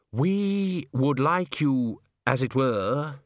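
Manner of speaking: slow, fussy theatre manager voice; slow and self-important; pompous manager. A narrator's reading.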